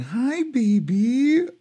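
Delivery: high, slow cooing to a pet. Real, not acted. Greeting the cat.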